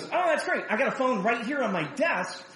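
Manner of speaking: high, eager mock cheer with a laugh; bright coworker voice. Pastor imitating a coworker's cheerful offer, lightly voiced. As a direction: play him sunny and helpful. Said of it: "And so he goes".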